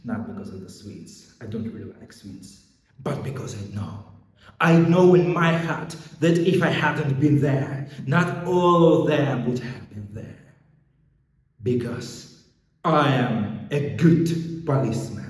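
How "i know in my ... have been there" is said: loud, raised, heartfelt insistence; impassioned conviction. Acted. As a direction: lift pitch and volume to insist. Policeman passionately justifies himself.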